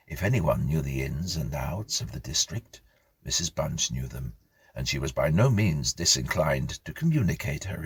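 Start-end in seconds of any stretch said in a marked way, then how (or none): none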